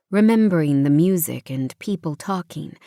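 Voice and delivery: plain reading voice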